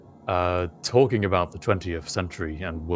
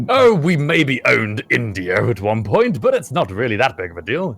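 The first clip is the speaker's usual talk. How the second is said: dismissive teacher; 5 semitones higher, 6 dB louder than usual. Retold or imitated. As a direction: brush it off like a glib teacher. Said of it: "other than".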